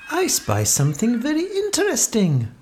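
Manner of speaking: high-pitched, intrigued cat voice; meowed to himself. A narrator's reading. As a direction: muse up high as a curious cat. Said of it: "Cod-eye meowed to himself".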